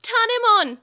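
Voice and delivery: very loud, high scream of a name; anguished scream. A narrator's reading. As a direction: cry out in despair. Children screaming partners' names.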